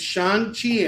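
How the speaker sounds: "Shang-Chi" as SHAHN-chee, slow and pointed; correct pronunciation. Shown as a demonstration. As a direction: stress the open "ah" in "Shang-Chi" to correct someone. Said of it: "say it the right way".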